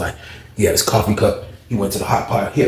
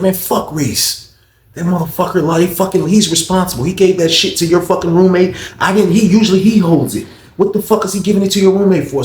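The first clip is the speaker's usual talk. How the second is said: higher, louder ranting guard; mock angry rant; 7 semitones higher, 3 dB louder than usual. Guard ranting about Reese to the inmate, heated imitated rant. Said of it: "He was just snapping".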